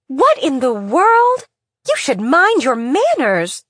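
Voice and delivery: high, loud, slow irritated scold; scolding mother. Acted. Mother scolding whoever keeps ringing the bell, loud and high. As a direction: snap at a rude caller through the door.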